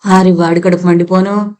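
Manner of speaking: plain reading voice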